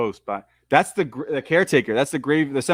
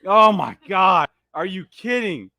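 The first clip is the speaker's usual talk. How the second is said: slow, higher, stunned excitement; awestruck exclaiming; 4 semitones higher, 3 dB louder, slower than usual. Real, not acted. Fan overwhelmed by the greatest scene, exclaiming in awe. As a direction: draw out each exclamation in amazement.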